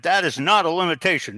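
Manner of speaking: half-hearted imitation of a furious pilot; mock angry retort. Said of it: "went ballistic".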